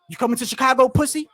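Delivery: loud mocking taunt. Mocking the troll who taunts him in chat, loud. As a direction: call it out like a dare.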